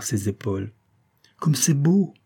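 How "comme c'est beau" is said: put-on high, delighted girl's exclamation; enthusiastic. A narrator's reading. Girl marvelling at the moonlit pond, enthusiastic. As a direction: exclaim in admiration, pitched well up. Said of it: "dit-elle avec enthousiasme".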